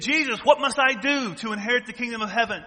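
put-on young ruler begging Jesus for an answer; rich man asking. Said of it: "He said".

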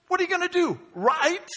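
high, mocking question, imitating someone; sarcastic. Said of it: "what sounded sarcastic".

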